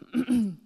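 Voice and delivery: clearing a scratchy throat. Real, not acted. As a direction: clear the throat after choking. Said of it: "J'ai avalé de travers".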